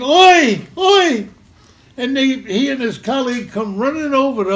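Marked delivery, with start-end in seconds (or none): 0.0-1.3 loud high Oi calls; retold screaming. Big man calling to the narrator, loud high Oi. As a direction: call out Oi with glee. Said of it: "just screaming".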